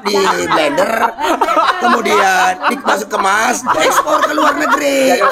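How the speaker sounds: high laughing shout. Real, not acted. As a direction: blurt it out while cracking up. Laughing joke rising high.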